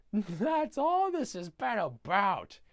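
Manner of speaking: high, slow drawn-out exclamation; Grinch rant. Retold or imitated. Grinch scolding the Whos, hammed-up drawn-out rant. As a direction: stretch it out in a high, theatrical voice.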